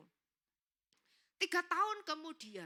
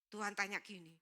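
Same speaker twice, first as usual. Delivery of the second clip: ordinary talk, nothing marked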